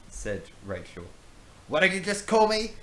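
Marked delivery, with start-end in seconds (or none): 1.7-2.7 much higher, louder indignant squawk; outraged pirate. Acted. Brownbeard insulted by Rachel, high loud outrage. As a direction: take huge offence, shrill and loud.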